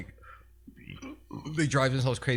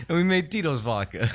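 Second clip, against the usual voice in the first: high, louder hammed-up folksy storyteller; Uncle Tito voice; 6 semitones higher, 5 dB louder than usual. Uncle Tito boasting how he invented his vodka, high and hammed-up. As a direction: spin the brand legend as a proud uncle.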